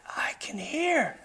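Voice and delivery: slow, high whispered mock scream; imitated amazed cry, kept very soft; retold scream. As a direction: stretch it out as a hushed scream. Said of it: "he's screaming at the top of his voice".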